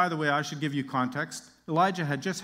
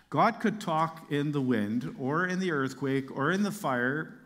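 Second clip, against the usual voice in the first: ordinary talk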